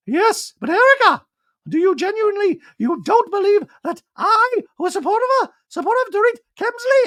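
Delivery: very high, loud, squeaky voice; Dorit impression. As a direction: squeak it like a posh socialite.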